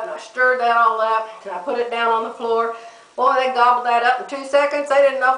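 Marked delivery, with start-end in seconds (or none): none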